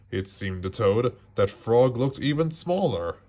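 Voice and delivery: usual speaking voice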